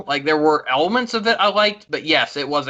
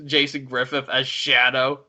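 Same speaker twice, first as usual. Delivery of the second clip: normal talking voice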